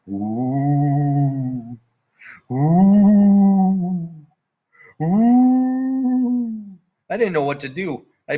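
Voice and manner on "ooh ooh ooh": rising, ghostly long ooh howl; eerie howl. Retold or imitated. Dog howling at the moon, heard as a ghostly wail. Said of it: "It sounded like".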